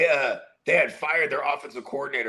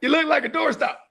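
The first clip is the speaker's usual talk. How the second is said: much higher, hammed-up teasing voice; mock doctor; 12 semitones higher than usual. Friend retelling a movie doctor scene, high teasing voice. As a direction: tease in a squeaky mock voice. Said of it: "He's like".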